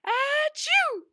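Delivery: a big high explosive achoo; loud sneeze. Acted. Mister Sneeze sneezing in the snow, big achoo. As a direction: let the sneeze burst out.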